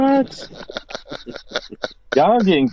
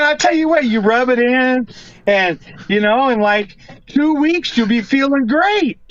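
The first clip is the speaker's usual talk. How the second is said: commercial voice; 5 semitones higher than usual. Acted. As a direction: sell it like a late-night ad. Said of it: "I can make a commercial on that".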